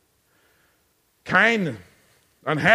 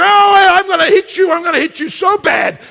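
high, loud, hammed-up threat; mock angry scream; 11 semitones higher, 9 dB louder than usual. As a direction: yell it like a furious intruder. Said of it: "they're screaming and they go".

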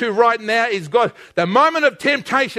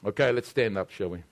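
low, soft, measured pastoral voice; quiet altar call; 10 semitones lower, 7 dB quieter than usual. Real, not acted. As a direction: speak gently to hearts before prayer.

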